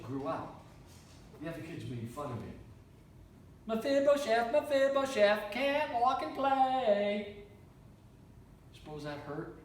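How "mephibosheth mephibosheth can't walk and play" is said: higher, slow, sing-song taunt; mocking children. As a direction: kids teasing the lame boy in a chant. Children mocking the crippled boy.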